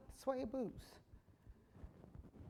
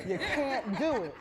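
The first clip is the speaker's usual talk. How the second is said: laughing; 5 semitones higher than usual. Real, not acted.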